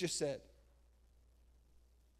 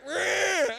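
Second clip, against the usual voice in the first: loud, much higher comic roar; mock sheep roar; 9 semitones higher, 8 dB louder than usual. Preacher mocking believers as dumb sheep, loud comic roar. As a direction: let out a silly charging-sheep yell.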